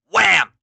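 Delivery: very loud hit; wham effect. A narrator's reading. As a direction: slam it. Impact effect.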